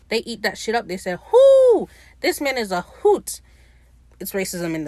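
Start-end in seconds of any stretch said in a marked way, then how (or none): 1.3-3.4 high, loud whoop of delight. Retold or imitated. Crowd cheering on Ed's story, delighted whooping. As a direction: mimic a crowd tickled by a guest.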